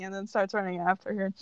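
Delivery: usual speaking voice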